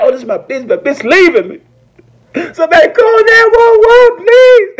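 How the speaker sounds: mock panic. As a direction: build from whiny to shrieking for help. Mock panic over being dumped.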